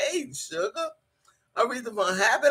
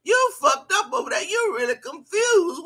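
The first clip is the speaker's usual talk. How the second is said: much higher, half-crying with laughter; laughing scolding; 8 semitones higher than usual. Real, not acted. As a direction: scold her while cracking up. Mocking commenter's mistake.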